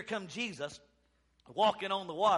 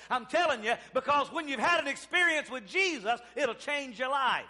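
raised, insistent testimony; rousing revival exhortation; full-force preaching; 4 semitones higher, 5 dB louder than usual. Real, not acted. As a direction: preach it with rising conviction.

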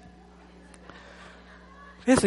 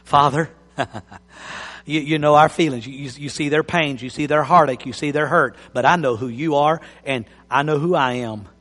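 low, soft intimate prayer; 6 semitones lower, 3 dB quieter than usual. Real, not acted. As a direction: pray over the hurting. Prays aloud for congregation.

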